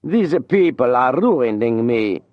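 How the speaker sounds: slow, lifted plea, a touch of alarm; mild cry. A narrator's reading. Singer complaining to Julien, a mild cry. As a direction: cry out that they are ruining him, lightly. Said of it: "he cried to Julien".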